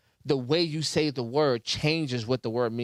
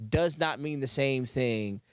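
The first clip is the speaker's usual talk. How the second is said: ordinary delivery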